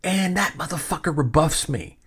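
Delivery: normal talking voice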